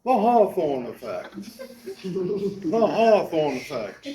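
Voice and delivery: hammed-up posh British take on Hawthorne; British accent. Shown as a demonstration. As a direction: play it up like a teacup Brit. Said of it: "you have to say it with a British accent".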